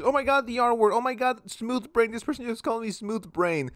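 high, wounded mock gasp; mock offended viewer. Imitating an offended viewer, high and wounded. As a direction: squeal it like someone deeply hurt by a meme. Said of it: "Goes to the room, cries".

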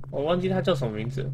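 usual speaking voice

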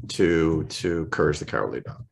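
ordinary talk, nothing marked

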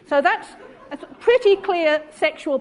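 amused disbelief, laughter breaking in; laughing. Real, not acted. Lecturer laughing at the quoted passage to the audience.

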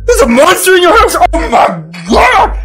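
high, hammed-up alarm; overdone shock; mock horror. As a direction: act appalled, pitch shooting up. Mock horror at Jacob's news.

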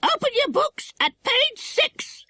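slow, very high parrot squawk; squawking mimicry of a schoolmaster; stern parrot. A narrator's reading. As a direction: order a class about in a bird voice. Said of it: "said Kiki sternly".

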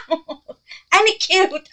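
high, loud laughing squeal; real high-pitched burst of excited laughter; ear-splitting squeal. Real, not acted. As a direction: squeal with delight, laughing and stretching the words. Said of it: "I probably just busted y'all's eardrums".

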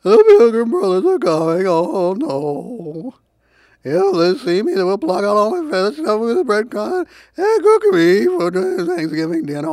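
high, wailing turkey in a tizzy; panicked turkey. A narrator's reading. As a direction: plead frantically in a high turkey voice. Narrator plays the panicked turkey.

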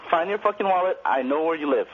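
ordinary talk, nothing marked

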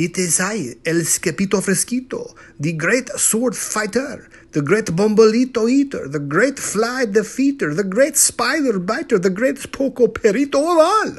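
put-on high, building Spanish-accented proclamation; swaggering list climbing to a loud peak; grand boasting. A narrator's reading. Kitten boastfully announces himself in accent.

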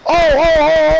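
loud, eager "oh, oh, oh" on spotting prey; excited shout. Real, not acted. Gamer spotting a survivor in the game, loud excited shout. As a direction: pounce on it with glee. Spots a fleeing player.

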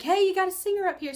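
high, loud call across the room; imitating a guy shouting; retold yell. A stage guy calling out to the tour guide, loud call. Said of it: "he yelled at my tour guide".

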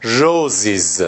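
روزیز slowly as roz-iz; slow iz ending. Shown as a demonstration. Teacher slowly models the plural for students. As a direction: draw out روزیز, landing on iz. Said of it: "باید ای بذارید که باسیز تلفظ بشه".

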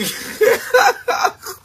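high airless laugh bursts out; sudden high giggle mid-confession; high breathy laugh. Real, not acted. Breaks down mid-sentence.

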